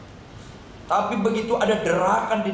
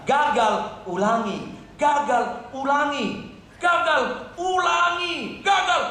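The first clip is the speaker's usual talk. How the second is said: building insistence; 11 semitones higher, slower than usual. Real, not acted. Preacher urges trying after every failure, mounting fervour. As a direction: chant climbing higher with each word. Preacher's chant of failing and retrying.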